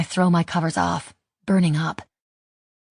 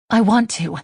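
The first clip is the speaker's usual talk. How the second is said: quick, eager, higher and louder; rushed reassurance; 5 semitones higher, 5 dB louder than usual. A narrator's reading. Haley eagerly assuring Mike she wants the date, rushed and louder. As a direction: blurt it out to reassure him fast. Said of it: "I rush to assure him".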